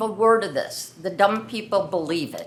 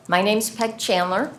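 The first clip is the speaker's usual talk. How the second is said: ordinary delivery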